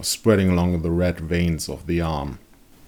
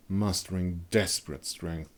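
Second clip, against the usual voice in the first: usual speaking voice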